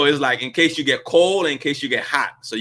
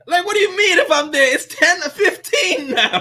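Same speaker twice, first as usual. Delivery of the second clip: incredulous, higher, through laughter; laughing disbelief. Real, not acted. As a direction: laugh while you can't believe it.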